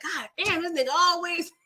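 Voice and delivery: mock fed-up grumble; imitated fuming. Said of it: "he fuming right now".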